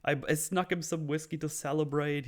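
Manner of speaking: high, gleeful villain gloat; elated gloating. Retold or imitated. Son bragging to the nurse, giddy and high. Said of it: "He's like, he's elated".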